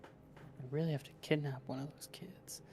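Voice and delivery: whispered aside, kept from the others; under my breath. Acted. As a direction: breathe it so nobody at the table hears. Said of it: "I say that under my breath".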